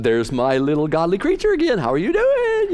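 high sugary falsetto greeting; hammed-up lady voice, very high on the last words; gushing church lady. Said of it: "And she went".

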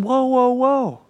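slow him down like a spooked horse; calming whoa. Retold or imitated. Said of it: "God's saying".

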